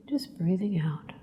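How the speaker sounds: soft unvoiced whisper on the exhale. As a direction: breathe the words out as a hush. Exhale cue in breathing exercise.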